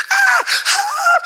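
high sobbing ah, ah; crying gasp. Retold or imitated. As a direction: break into a high sob. Woman overwhelmed, starting to cry.

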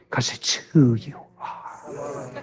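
slow, hushed whisper to the congregation. Real, not acted. Preacher whispers the point to his congregation, slow hush. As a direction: lean in and breathe it slowly. Preacher drops to an intimate aside.

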